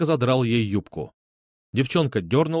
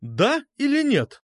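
high, impatient demand; angry bark; 10 semitones higher than usual. A narrator's reading. Medusa presses silent girl to answer, barking. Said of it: "рявкнула Медуза".

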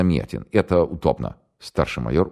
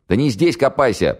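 sharp shout at the sapper, higher and louder; окрик; 9 semitones higher, 3 dB louder than usual. Acted. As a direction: bark it out over the noise. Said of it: "прикрикнул он на сапёра". (майор прикрикивает на сапёра)

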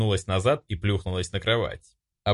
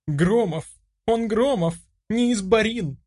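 high-pitched desperate inner cry; desperate, high exclamations; desperate thought; 12 semitones higher than usual. A narrator's reading. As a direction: think it frantically, pitch climbing. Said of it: "Отчаянно билась в голове мысль".

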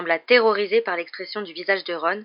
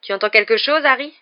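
louder, higher pointed question; pointedly ignore someone, grumbling loudly; irritated snub; 6 semitones higher, 8 dB louder than usual. A narrator's reading. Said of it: "grogna Ron sans accorder un regard".

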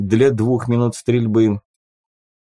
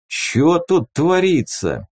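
slurred speech; 8 semitones higher, slower than usual. Acted. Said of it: "заплетающимся языком произнёс он".

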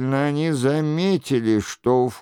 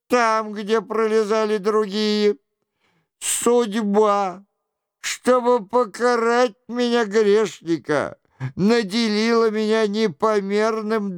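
lamenting cry; 7 semitones higher than usual. A narrator's reading. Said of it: "кричал Горанфло". (высокий горестный плач)

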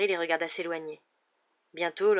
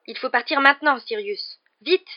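breathless urging; 7 semitones higher, 10 dB louder than usual. Acted. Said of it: "la respiration haletante".

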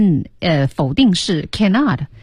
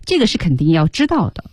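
ordinary delivery

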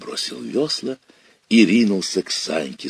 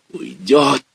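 yelling; 6 semitones higher than usual. Acted. Boy feels the fish going under the boat, panicked yell. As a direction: wail that the fish is escaping. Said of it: "завопил я".

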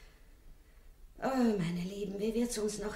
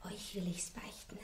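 normal talking voice

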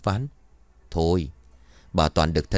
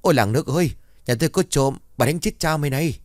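screaming for the village; 7 semitones higher, faster than usual. Acted. Wife beating the thief yells for the whole village, high screaming. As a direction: yell it out high to rouse the neighbours. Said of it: "vừa gào lên".

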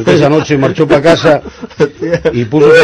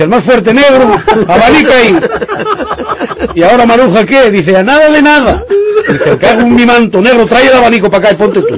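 urgent shout; 7 semitones higher than usual. Acted. Husband urges the fanner.

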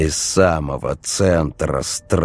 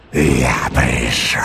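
lower, hoarse cry; hoarse shout; 5 semitones lower than usual. A narrator's reading. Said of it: "закричал Виктор хриплым голосом".

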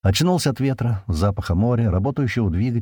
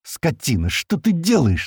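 angry shout rising higher; 7 semitones higher, slower than usual. A narrator's reading. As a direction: yell at the tormentor. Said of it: "закричал уже я".

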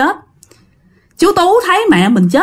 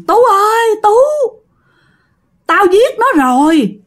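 possessed confession; 10 semitones higher than usual. Retold or imitated. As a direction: confess the murder like a woman possessed, voice pitched high. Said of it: "bà nói giống như ai khiến vậy đó".

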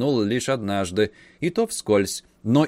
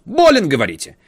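loud, high angry cry; angry outburst; 7 semitones higher, 9 dB louder than usual. A narrator's reading. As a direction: threaten him furiously. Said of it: "вскричал он".

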